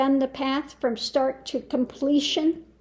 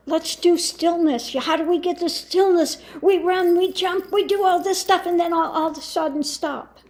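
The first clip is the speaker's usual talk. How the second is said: mock hurried eagerness, a little raised; Osho; 3 semitones higher, faster than usual. Teacher mocking a guru's stillness method, hurried mock eagerness. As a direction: rattle it off as a busy parody. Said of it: "It's just like Osho".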